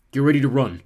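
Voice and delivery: loud, firm order; urgent warning. A narrator's reading. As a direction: brace them to run. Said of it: "he told us".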